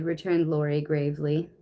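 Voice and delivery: ordinary delivery, nothing marked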